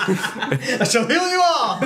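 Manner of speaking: high, drawn-out preacher proclamation; slow, hammed-up healing minister; faith-healing minister. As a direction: stretch it out like a revivalist healer. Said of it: "like one of those ministers".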